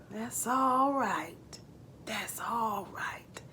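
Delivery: put-on calm a frightened child in a whisper; soothing whisper. Papa John comforts upset Jake, slow soothing whisper. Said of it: "Papa John soothes him".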